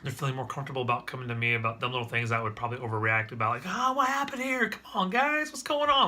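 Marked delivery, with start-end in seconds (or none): 3.7-6.1 put-on high, exasperated outburst at the kids; mock overreacting dad. Dad imitating how he used to snap at his kids, overreacting. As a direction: ham up a dad losing patience over nothing.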